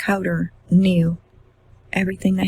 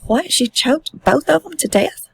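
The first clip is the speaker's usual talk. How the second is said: higher pitched, a touch of horror; horrified disbelief; 7 semitones higher than usual. Retold or imitated. A father questioning his son's confession, horrified. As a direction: recoil in disbelief as you ask. Father hears children were choked.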